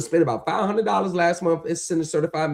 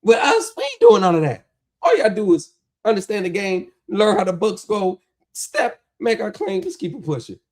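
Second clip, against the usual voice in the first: higher and louder, selling it hard; hyped boast; 6 semitones higher, 4 dB louder than usual. Real, not acted. Vlogger to viewers, bragging about his winning method, excited and loud. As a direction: pump it up like you're bragging about your own system.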